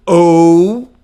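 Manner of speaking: ou held long and loud; English ou sound. Shown as a demonstration. Teacher demonstrates the ou vowel for students. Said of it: "que é esse som".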